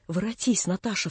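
usual speaking voice

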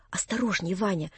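ordinary delivery

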